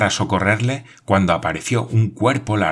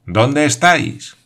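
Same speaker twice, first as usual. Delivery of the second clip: slow raised call up to a tower; 6 semitones higher, 5 dB louder, slower than usual. Acted. Glenarvan calls up to Paganel in the tower, raised voice. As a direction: call up to him from below, slow and loud.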